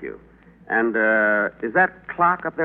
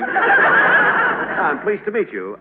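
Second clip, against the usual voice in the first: laughing; 7 semitones higher, 4 dB louder, much slower than usual. Acted. Laughing greeting.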